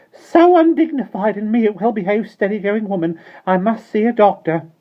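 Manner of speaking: murmuring. A narrator's reading. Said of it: "murmuring as she went".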